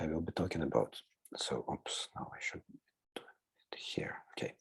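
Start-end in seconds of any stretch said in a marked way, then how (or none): 1.4-4.5 soft murmur to himself; breathy under-the-breath aside; whispered aside. Real, not acted. Muttering to himself while navigating slides.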